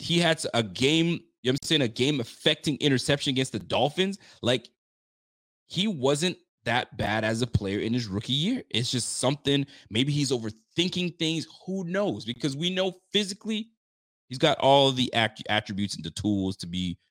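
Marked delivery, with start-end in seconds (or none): none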